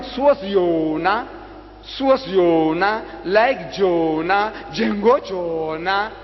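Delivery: very slow, stretched-out "Jonah"; slow, deliberate sing-out of each phrase; drawn-out repetition. Shown as a demonstration. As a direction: spell it out slowly, dragging each word. Drumming in the repeated phrase.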